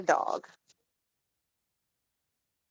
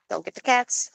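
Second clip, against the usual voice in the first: usual speaking voice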